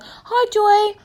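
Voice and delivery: high, loud mock hello; passer-by's greeting. Acquaintance walking down the street, mock high call. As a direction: sing out a greeting in an imitated high voice. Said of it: "you walking down the street going".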